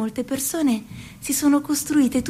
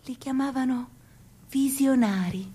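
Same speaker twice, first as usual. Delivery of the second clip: normal talking voice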